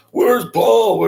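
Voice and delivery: high, loud retold yell; mock scream. Guy coming out of the club calling for someone, mock screaming. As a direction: imitate a man screaming out for someone. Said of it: "like, screaming".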